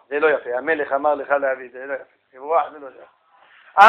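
low-pitched thinking aloud; acted musing. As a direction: drop low, as if dismissing your own idea. Acted weighing of an option aloud. (הרהור מוצג בקול נמוך)

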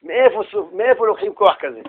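ordinary talk

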